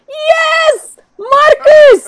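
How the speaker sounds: high, loud excited squeal at Marcus; excited shriek. Real, not acted. Streamer shrieks at her Sim finding someone home, excited squeal. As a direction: shriek out in startled delight.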